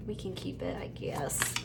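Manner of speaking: a soft unvoiced whisper to herself; hushed whisper; whispered aside. Real, not acted. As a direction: breathe it to yourself.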